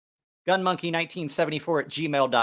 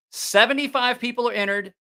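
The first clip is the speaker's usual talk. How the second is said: hyped giveaway announcement; 4 semitones higher, 3 dB louder, slower than usual. Real, not acted. Drawing the giveaway winner live.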